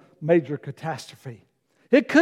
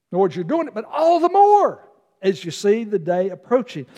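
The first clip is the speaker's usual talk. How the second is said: normal talking voice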